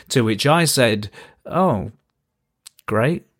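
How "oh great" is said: reluctant. Retold or imitated. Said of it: "Somewhat reluctantly".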